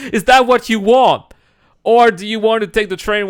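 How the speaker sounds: rising exasperated rant about missing the train; mounting rant. Real, not acted. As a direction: build the rant up to a loud peak. Building rant about train wait.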